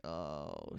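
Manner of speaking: low, soft groaning oh; mock groan. Mel groans at Jack's reassurance, low soft groan. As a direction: let out a quiet low groan. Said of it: "And Mel groans".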